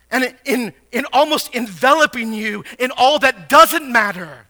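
high, loud, impassioned preacher; full-force preaching. Acted. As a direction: preach it hard, pressing each question on the listeners.

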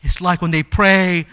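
ordinary delivery

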